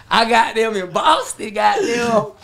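bragging, fired up; hyped. Real, not acted. Rapper telling the host about Boston, loud and hyped. Hyping his Boston show.

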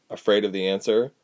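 usual speaking voice

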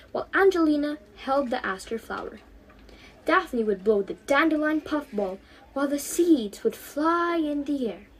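ordinary delivery, nothing marked

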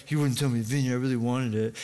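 whiny mock sulk of a spoiled king. Ahab complains to his wife about the vineyard, sulking. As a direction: pout through the complaint, mock-pitiful. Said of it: "He was sulking".

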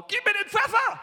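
loud, high shout of a man who can't hear himself; deaf shout. Retold or imitated. Half-deaf husband asking his wife for pepper, shouting. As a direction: bellow across the dinner table. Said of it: "Ich sag zu meiner Frau".

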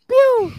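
a sharp, high pew like a toy gun; gunshot noise. Pretend. Shipper acting out a shot at BL couples, high pew. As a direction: fire off a pew sound.

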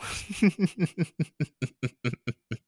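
high, drawn-out cackle; long laugh. Real, not acted. Laughs after I know.

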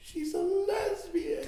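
slow, high, hammed-up wail; crying mom. Mom breaking the news to her kids, wailing. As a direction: sob the revelation out in mock grief. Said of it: "she's crying".

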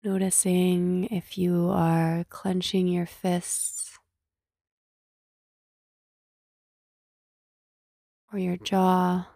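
slow meditation voice with long pauses; guided meditation. Acted. Host leading listeners to scan body and breath, guided meditation. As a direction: gently guide listeners into stillness, unhurried.

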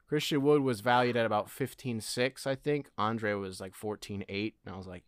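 ordinary delivery

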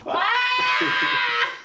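piercing, sustained mock scream; high-pitched screech. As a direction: screech like an over-the-top mom. Mom screeches in answer.

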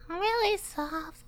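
put-on high, slow, eerie whisper of a girl; scary little girl voice. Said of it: "I liked her voice".

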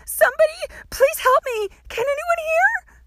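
high, loud, desperate screaming; panicked, crying shout for help; screamed plea. A narrator's reading. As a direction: scream for rescue in rising desperation. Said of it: "she screamed".

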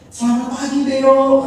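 calls out a high, loud good morning to the parrot; hearty greeting. Retold or imitated. Husband shows the pastor how to greet the parrot, calling out loud. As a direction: greet the bird brightly and loud.

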